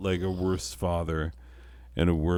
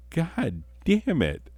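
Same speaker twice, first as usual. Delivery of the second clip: wife exasperated; 11 semitones higher, slower than usual. Retold or imitated. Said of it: "my wife was like".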